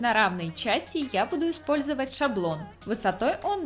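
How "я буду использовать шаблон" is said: ordinary talk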